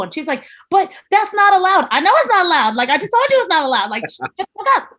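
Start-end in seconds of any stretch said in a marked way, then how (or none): 0.7-1.9 high, loud squeaky protest; hammed-up alarm, pitched way up; mock panicking. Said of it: "she's panicking the entire time". 1.9-4.9 high-pitched retold snapping back; mock fed-up outburst; exasperated. Exasperated retort to panicking trainee.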